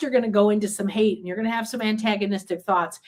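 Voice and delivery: ordinary delivery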